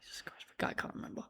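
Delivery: hushed teasing aside; whispering. Real, not acted. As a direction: whisper it behind his back. Aside jab at co-host.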